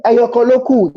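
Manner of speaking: high singsong hawker's cry; high market seller's call. Retold or imitated. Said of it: "what she says is".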